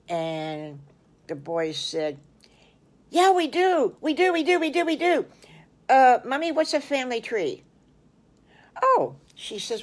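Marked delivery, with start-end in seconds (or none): 3.1-7.6 high, loud, bouncing little-pig voices; excited kids. Acted. Excited piglets shout to mommy. 8.8-9.1 high squeaky exclaimed oh; mommy piggy reacting, very high; surprised oh.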